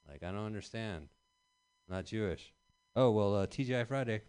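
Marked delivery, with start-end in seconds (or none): none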